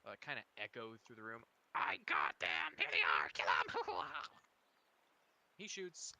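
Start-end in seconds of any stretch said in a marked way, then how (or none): 1.7-4.2 loud, low goblin yelling; goblin shout. Acted. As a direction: yell like a goblin lookout. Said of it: "you can just hear his muffled voice".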